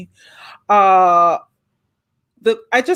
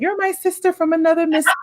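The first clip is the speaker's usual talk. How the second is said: higher, faster, teasing sing-song; playful catchphrase; 8 semitones higher, faster than usual. Retold or imitated. Hostess quoting her pet line to her friend, higher and quick. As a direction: toss it off like a running joke. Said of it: "I always tell her that".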